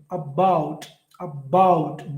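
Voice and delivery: low "about" with a drawn "bout"; stress on second syllable. Shown as a demonstration. Teacher demonstrating to the class, "about" stressed on "bout". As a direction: lean on "bout" in each "about". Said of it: "by is stressed here".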